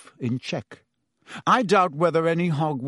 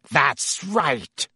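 icy, sneering interruption; cold sneer. A narrator's reading. Snape breaks in behind Lupin, coldly sneering. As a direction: cut in with cold contempt. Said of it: "sneered a cold voice".

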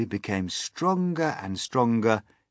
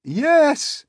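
high, loud shout; loud high yell; crowd's cry; 10 dB louder than usual. Acted. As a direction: cry out eagerly as a whole crowd. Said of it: "they cried".

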